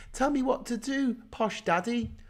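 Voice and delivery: very high, needy little voice; mock fawning. Besotted brickie begs a posh person for orders, mock fawning. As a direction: plead adoringly in a put-on high voice.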